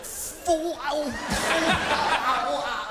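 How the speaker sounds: high, slow oh-oh of a scalding mug; hammed-up hot-tea yelps; mock pain. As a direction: wince as the tea burns. Mimes hot full mug spilling.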